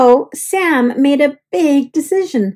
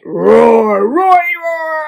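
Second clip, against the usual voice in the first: playful monster roar; 3 semitones higher, much slower than usual. Acted. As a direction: roar happily like a friendly monster. Monsters delighted, roaring playfully.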